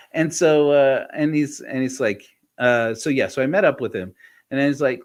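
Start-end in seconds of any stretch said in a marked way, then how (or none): none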